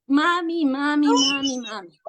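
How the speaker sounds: repeated begging "mami", breaking into a sob at the end; pleading with mom. Retold or imitated. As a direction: beg your mother, voice cracking into a cry. Begging her mother for help. (suplicando a la mamá, quebrándose en llanto)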